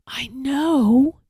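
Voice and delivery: whispered tiny call from far off; faint whispered call; faint called reply. A narrator's reading. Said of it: "called the voice".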